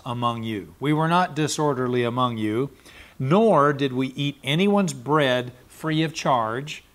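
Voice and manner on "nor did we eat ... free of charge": normal talking voice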